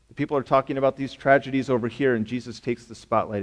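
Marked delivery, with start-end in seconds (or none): none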